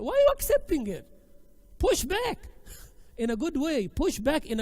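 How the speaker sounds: loud, high exasperated challenge; forceful challenge. Real, not acted. Teacher challenging sisters to push back, forceful challenge. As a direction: cry out to rouse them.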